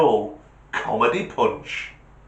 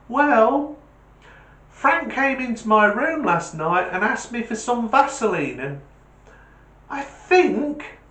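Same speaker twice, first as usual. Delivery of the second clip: put-on innocent kid; 11 semitones higher, 3 dB louder than usual. [name] delivers punchline.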